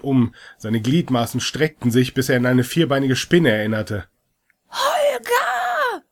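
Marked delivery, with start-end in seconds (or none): 4.7-6.0 high, strangled shout; gasping cry. Acted. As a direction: gasp out the name in panic. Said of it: "japste die Oma".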